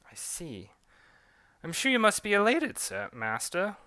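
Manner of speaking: put-on whisper, then rise in a British accent; British accent, faintly mocking. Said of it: "a British and French accent".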